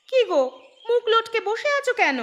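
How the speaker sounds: scolding call. Acted. Wife scolds hungry husband.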